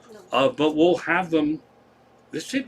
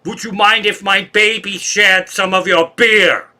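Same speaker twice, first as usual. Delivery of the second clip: [name]'s creepy doll act; 6 semitones higher, 8 dB louder, slower than usual. Retold or imitated. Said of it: "she said".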